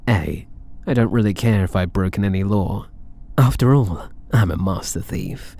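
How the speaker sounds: ordinary talk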